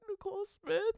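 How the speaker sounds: high, choked-back little answer; holding back tears. Retold or imitated. Patient tells nurses their name, trying not to cry. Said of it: "I'm trying not to cry".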